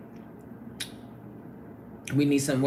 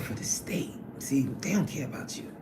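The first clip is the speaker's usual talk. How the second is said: quick conspiratorial whisper; 9 dB quieter than usual. Real, not acted. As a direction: lean in and whisper it fast. Confiding about her employer.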